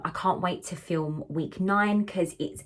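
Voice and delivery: usual speaking voice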